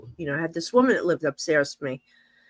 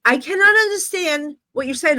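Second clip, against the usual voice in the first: higher, emphatic retold protest; mock exasperated raised voice; exasperated yelling back; 7 semitones higher, 4 dB louder than usual. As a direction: act out calling back in frustration. Acting out yelling back at the screamer.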